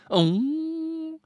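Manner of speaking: high, sustained "ooh" noise; new noise. Shown as a demonstration. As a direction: hold one high, steady tone. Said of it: "that's my new ... noise".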